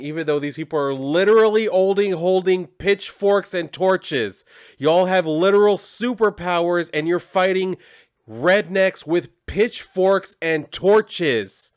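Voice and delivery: incredulous ranting at the characters; exasperated rant. Real, not acted. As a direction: spell out how ridiculous it is, slowly. Ranting at absurd fight.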